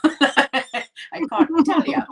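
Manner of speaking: high, slow, laughing through it. Real, not acted. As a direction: laugh the words out. Laughing about being poor.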